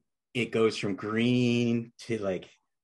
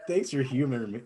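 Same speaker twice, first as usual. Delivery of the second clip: normal talking voice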